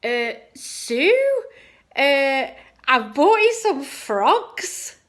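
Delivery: high, loud, hammed-up impression; impersonating a friend. Imitating the birthday friend addressing Sue, high and loud. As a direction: call out high and slow, swooping up. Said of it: "probably the best impression".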